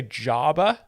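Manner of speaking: Jabba as JAH-buh, questioning lift at the end; alternative pronunciation. Shown as a demonstration. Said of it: "oh, they say".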